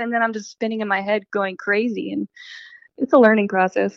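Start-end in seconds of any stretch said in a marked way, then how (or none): none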